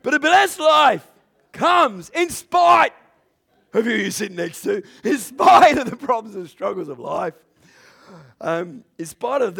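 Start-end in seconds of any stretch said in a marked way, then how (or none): 0.0-7.3 preaching at full force. Acted. Preacher proclaiming the blessed life, loud and high. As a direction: build the point into a shout.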